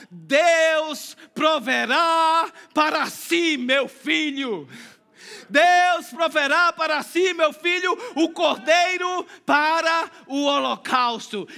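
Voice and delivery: slow, shouted prophetic declaration; proclaiming. Acted. Preacher shouting Abraham's prophecy to the congregation. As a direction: thunder it out slowly like a prophecy.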